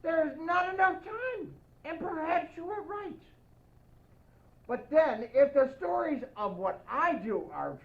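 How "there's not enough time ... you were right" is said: ordinary delivery, nothing marked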